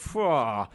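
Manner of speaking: exaggerated reeling "phew" exhale; mock disgusted phew. People reacting to someone's B O, mock disgust. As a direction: blow out a mock stink reaction. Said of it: "oh, people go".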